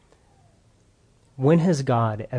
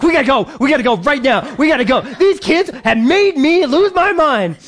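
much higher, louder frantic urging; frantic hurry; 11 semitones higher, 5 dB louder than usual. Retold or imitated. Pastor reenacting shouting to his wife to leave. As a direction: rush everyone out in a mock panic. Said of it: "and I'm like".

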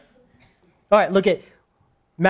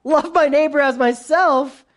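higher-pitched, doubtful echo of the command; honest humility; 8 semitones higher than usual. Acted. Humility hears the command to love, doubtfully. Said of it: "Humility says".

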